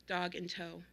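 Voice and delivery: usual speaking voice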